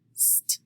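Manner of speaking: "st" as one short joined sound; blended sounds. Shown as a demonstration. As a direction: run s and t together in "st". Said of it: "we put them together".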